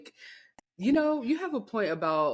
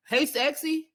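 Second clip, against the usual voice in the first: higher, scornful echo of a catcall; incredulous mocking; 8 semitones higher, 4 dB louder, slower than usual. Woman repeating a man's catcall, incredulous. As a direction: throw the pickup line back in disbelief.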